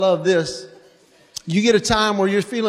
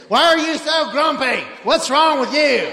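mock yelling mom; 6 semitones higher than usual. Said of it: "just yelling at the kids".

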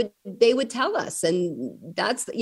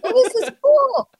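put-on high, louder happy child voice; mock excited kid; 7 dB louder than usual. Mom imagining what a typical kid would say, mock-excited. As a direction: pitch way up like a thrilled kid. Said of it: "You would think a kid would be like".

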